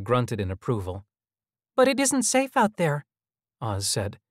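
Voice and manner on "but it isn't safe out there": put-on very high child's voice; worried child hologram. A narrator's reading. Child hologram warning visitors against leaving, high and urgent. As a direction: plead high, like a little boy.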